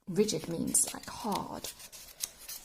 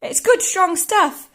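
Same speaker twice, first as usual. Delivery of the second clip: put-on high, loud, measured boy character; slow it down, pitched up; George reassuring Grandma; 10 semitones higher, 9 dB louder than usual. George praising his medicine to Grandma, high slow assurance.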